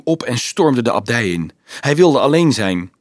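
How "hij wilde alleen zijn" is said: usual speaking voice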